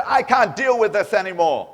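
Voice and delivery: loud, exasperated retold outburst; enraged outburst. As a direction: blurt it out like an angry husband at his limit. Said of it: "And yet he still got enraged".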